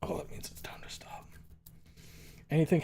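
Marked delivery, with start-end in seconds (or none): 0.0-1.1 breathy whisper repeat. Pretend. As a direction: whisper it this time. Second take whispered.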